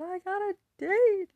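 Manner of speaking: high, loud, tearful wail reliving the fear; imitating her younger self crying; retold sobbing. Telling her dad about the first date while sobbing. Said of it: "I'm sobbing".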